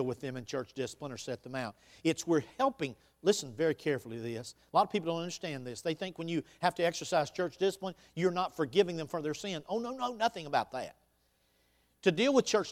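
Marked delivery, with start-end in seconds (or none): none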